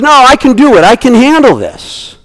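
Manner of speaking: Peter insisting he can handle it; a stubborn disciple, pitched well up; insistent Peter. Acted. Peter insists, voiced high.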